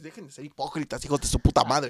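loud mock-angry rant, last words yelled. Real, not acted. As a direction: yell it at the hypocrites. Ranting at fake fans. (grito de reclamo burlón a los hipócritas)

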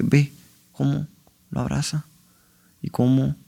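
casi sin voz, mucho más bajo y entrecortado; imitando llanto quedo. Retold or imitated. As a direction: habla conmovido, a punto de llorar. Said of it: "empieza a llorar". (David Lynch describe la escena, conmovido y en voz baja)

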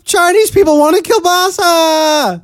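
high, slow mock comic delivery; Norm Macdonald style. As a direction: ham up the joke teller voice. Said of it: "It's a Norm Macdonald joke".

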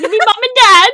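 high, loud mock British through laughter; bad British accent. Co-host topping the running joke, bad British accent.

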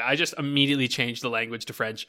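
ordinary talk

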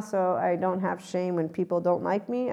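normal talking voice

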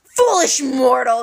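loud, slow roar; bellow. Acted. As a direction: bellow down at the hero. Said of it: "he bellowed".

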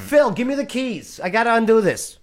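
mock officer. Acting out officer freeing arrestee.

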